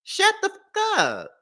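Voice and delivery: high, slow, drawn-out shut the fuck up; mock hush. Vlogger mocking the husband, high and drawn-out scolding. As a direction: scold him like a nagging aunt.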